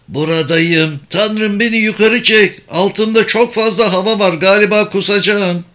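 high-pitched distressed plea; weak, trembling voice. A narrator's reading. Mimo dangling over empty air calls up to his brother, distressed. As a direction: voice pitched well up, pleading for rescue. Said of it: "zayıf ve titrek bir ses duyuldu".

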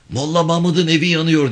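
high, loud cry of alarm; shouted alarm. Acted. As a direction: yell out that the house is burning. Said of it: "diye bağırdılar". (yüksek sesle telaşlı bağırış)

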